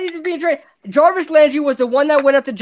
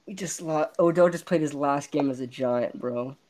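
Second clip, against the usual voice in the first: much lower and far softer, crushed; real heartbroken quiet; crushed murmur; 13 semitones lower, 10 dB quieter than usual. Real, not acted. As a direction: let it out barely audible. Mourning star's trade.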